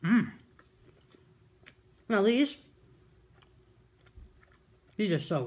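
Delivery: eating and talking; talking while chewing. Real, not acted. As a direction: mouth partly full of food. Seen 0: Bites nugget at -2, then talks with chewing mouth throughout.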